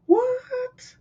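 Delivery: very high, squeaked what; floored that he planted it for him, squeaky what; squeaky disbelief. Retold or imitated. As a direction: let your voice crack up in total disbelief.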